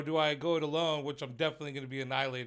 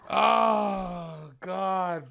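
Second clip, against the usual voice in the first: loud grossed-out groan; disgusted shout; 4 semitones higher, 5 dB louder than usual. Real, not acted. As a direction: yell it out in disgust. Grossed out reaction.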